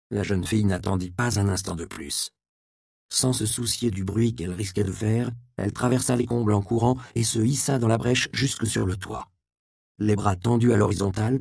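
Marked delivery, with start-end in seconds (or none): none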